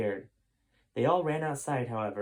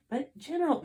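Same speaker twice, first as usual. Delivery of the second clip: high, pleading assassin; very high cut-off plea; high-pitched plea to the general. Acted.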